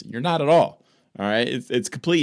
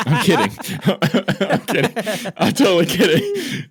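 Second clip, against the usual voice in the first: real laughter through the words, higher and louder; laughing; 7 semitones higher, 5 dB louder than usual. Real, not acted. Friend teasing Jesse after a mock conclusion, laughing it off. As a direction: crack up while taking back the joke.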